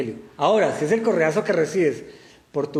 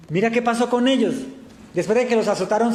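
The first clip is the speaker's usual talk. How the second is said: normal talking voice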